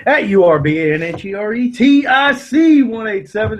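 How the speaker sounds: tipsy, slurring the letters. Real, not acted. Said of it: "It's probably slurred 'cause I'm a little drunk".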